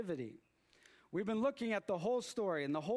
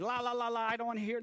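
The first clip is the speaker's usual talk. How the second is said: put-on louder, higher singsong like a kid; childish la-la; 4 semitones higher, 3 dB louder, faster than usual. Fan blocking a coworker's spoiler, mock childish singsong. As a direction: drown him out like a child. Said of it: "when kids like".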